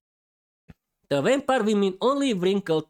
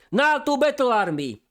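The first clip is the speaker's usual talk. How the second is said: rallying call, raised and commanding; battle cry; 7 semitones higher than usual. Acted. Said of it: "fully controlled by the vampire".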